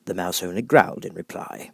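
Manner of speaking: plain reading voice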